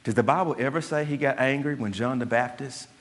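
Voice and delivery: ordinary talk, nothing marked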